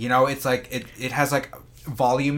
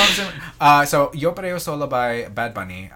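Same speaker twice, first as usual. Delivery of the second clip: ordinary talk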